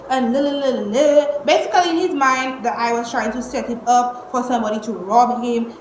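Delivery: normal talking voice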